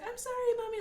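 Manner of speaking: high-pitched imitation of a sorry little girl; apologetic child. Mom imitating her daughter's instant apology, high pleading child voice. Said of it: "she'll be like".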